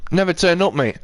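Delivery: usual speaking voice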